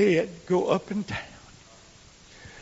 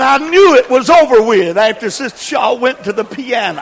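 louder, higher tell-off; preacher retelling the boy's line; 7 semitones higher, 11 dB louder than usual. Retold or imitated. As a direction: announce it with a grin. Boy quoted, then laughter.

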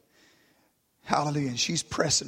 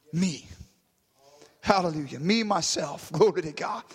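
usual speaking voice

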